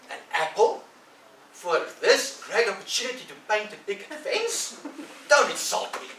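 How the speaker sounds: put-on high, loud indignant boy; mock-offended Tom. Tom scorning Ben's apple offer, loud mock indignation. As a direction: act insulted, haughty.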